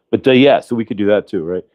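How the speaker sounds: ordinary delivery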